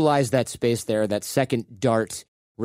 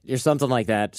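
ordinary talk

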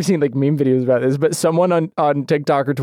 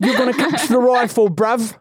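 higher, laughing fake London voice; mock British roadman; 5 semitones higher than usual. American teasing British friend, mock London accent. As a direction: tease in a put-on London accent.

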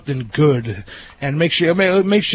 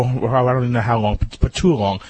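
ordinary talk

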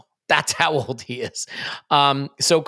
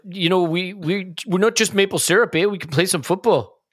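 put-on Canadian accent; 3 semitones higher than usual. Podcaster mimicking a Canadian defending their team, mock Canadian accent. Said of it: "Canadian person said it".

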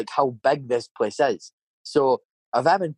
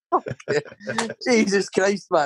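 slow, high curse through a laugh; laughing disbelief; 7 semitones higher, much slower than usual. Retold or imitated. Recalling his worker fighting himself, slow laughing disbelief. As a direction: drag it out, half laughing, half appalled.

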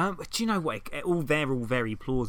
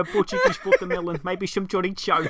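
a hammed-up Portuguese accent, higher and louder; mock Portuguese accent; 5 semitones higher, 3 dB louder than usual. Showing off a Portuguese impression to his co-host, in a hammed-up Portuguese accent. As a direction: roll the words in a fake Portuguese lilt, slowing on chorizo. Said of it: "Portuguese is more like".

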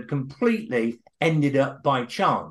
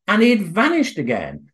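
ordinary delivery

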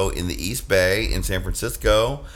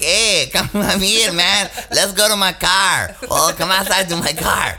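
Midge voice; 8 semitones higher than usual. Retold or imitated. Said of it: "And then the Midge is like".

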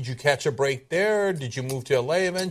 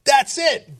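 high, loud mock discovery cry; excited agent; 10 semitones higher, 7 dB louder than usual. Host playing an agent spotting talent, mock-excited shout. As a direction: exclaim it like an agent finding a star.

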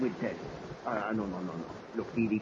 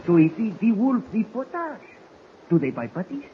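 rising excitement, louder; excited storytelling; 5 dB louder than usual. Acted. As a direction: build the tall tale up big. Baptiste tells wolf tale.

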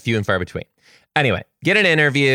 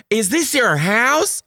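put-on high, slow curious child; little kid voice; 12 semitones higher, slower than usual. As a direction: ask wide-eyed like a five-year-old. Voicing the kindergartner.

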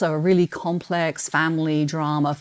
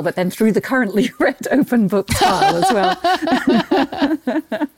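laughing through the words, slower; 8 semitones higher, 4 dB louder, slower than usual. Real, not acted. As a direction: giggle the last half out. Laughs about her reading pile.

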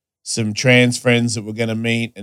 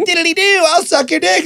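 very high, tiny sing-song come-on; impish little woman voice. Retold or imitated. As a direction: chirp it like a tiny mischievous sprite.

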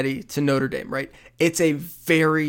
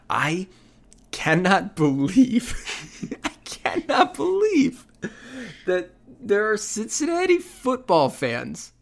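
mock disbelief; 6 semitones higher, slower than usual.

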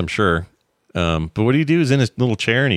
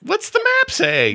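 very high, drawn-out mock cartoon voice; slow sing-song falsetto, mocking a children's show; kids' cartoon impression; slower than usual. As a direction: parody an over-eager cartoon question. Mimicking a disliked children's show.

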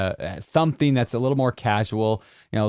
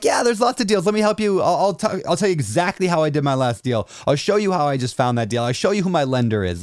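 high, eager, helpful voice; friendly investor voice; 6 semitones higher than usual. Retold or imitated. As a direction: play the open, generous investor.

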